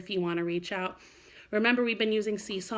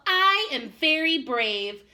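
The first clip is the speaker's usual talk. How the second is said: slow, higher, mildly determined little bird; small robin's brave words; 7 semitones higher, 7 dB louder, much slower than usual. Acted. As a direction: tell yourself you're brave, slowly. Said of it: "said to himself".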